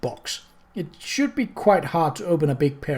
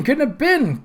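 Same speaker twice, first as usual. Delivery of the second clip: second time much higher, insistent; protesting; 11 semitones higher than usual. A narrator's reading. Groat protests to Moist, rising insistence. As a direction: refuse to believe it. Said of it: "Groat protested".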